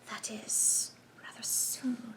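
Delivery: slow, hushed hissing whisper; soft considering whisper; slow sibilant whisper. A narrator's reading. The Russian considers.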